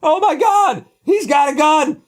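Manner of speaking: fake panic. Pretend 911 caller faking a gun threat in panic. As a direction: overact the emergency to get them there. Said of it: "call them up and just go".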